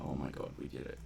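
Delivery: ordinary delivery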